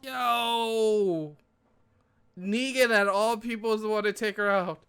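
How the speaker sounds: high, loud hyped cheering. Real, not acted. Viewer cheers Negan taking her out, high and loud. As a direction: cheer on the twist.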